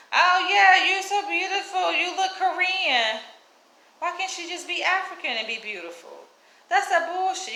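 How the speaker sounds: high mock gushing voice; mocking the lady. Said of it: "My mom talking about".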